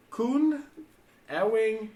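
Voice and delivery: high, loud, word-by-word reading; deliberate read-out. Real, not acted. Reading the garbled translation to co-player, slow and deliberate. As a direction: announce each odd word separately.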